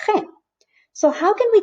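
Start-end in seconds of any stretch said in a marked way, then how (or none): 0.1-0.2 G said as a throaty "kheh"; G as the Spanish letter name, guttural. Shown as a demonstration. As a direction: say G with a scrape at the back of the throat. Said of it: "it's pronounced G".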